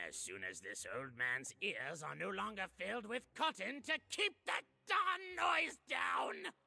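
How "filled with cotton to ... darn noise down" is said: grumpy shout. Acted. Grumpy old man complaining.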